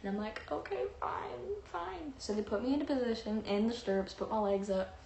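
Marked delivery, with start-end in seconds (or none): 0.5-2.1 grudging surrender. Retold or imitated. Patient giving in to staff, hammed-up reluctance. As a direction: give in grudgingly, ending soft and high.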